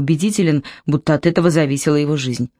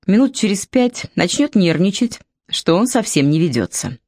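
plain reading voice

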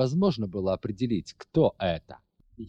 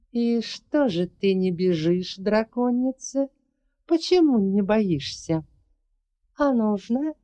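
sneering; 11 semitones higher than usual. Acted. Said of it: "она процедила в ответ".

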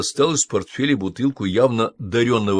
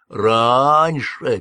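mocking echo; 2 semitones higher, 5 dB louder than usual. A narrator's reading. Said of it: "передразнил Аид".

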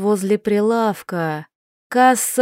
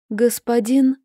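trembling, frightened voice; shaky voice; 2 semitones higher than usual. A narrator's reading. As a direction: let the voice shake. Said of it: "Голос дрожал".

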